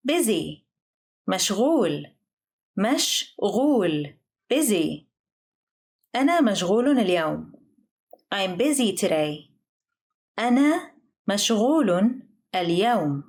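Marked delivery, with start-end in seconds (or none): 2.8-4.1 "مشغول" split into two separate syllables. Shown as a demonstration. Vocabulary drill, broken into syllables. 10.4-13.2 "أنا مشغول اليوم" with a pause after each word; careful, slowed teaching take of "أنا مشغول اليوم". Shown as a demonstration. Example phrase, slow teaching take.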